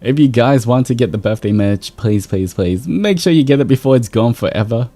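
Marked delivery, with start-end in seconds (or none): none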